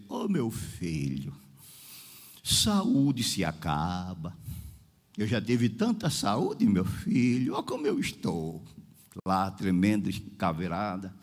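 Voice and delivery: put-on low, slow, weary old-timer's counsel; frail sick woman. Dying sister warns her visitor that health ends, low and weary. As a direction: imitate a weak woman, low and slow, with long pauses. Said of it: "aí ela começou a dizer".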